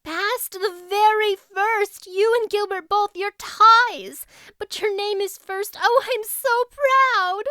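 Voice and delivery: put-on girl shouting the happy news; excited cry. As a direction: cry it out to her friend in delight. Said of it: "she cried".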